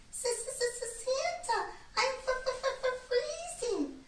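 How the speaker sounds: very high, slow, shivering bird voice; a freezing little bird, teeth chattering; chattering tiny bird. A narrator's reading. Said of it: "a tiny bird chattered".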